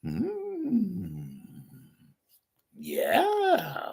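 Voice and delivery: high, hushed true crime narrator; dramatic breathy crime show voice; Keith Morrison impression. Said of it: "do another Keith Morrison impression".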